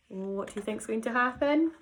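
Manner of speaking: ordinary delivery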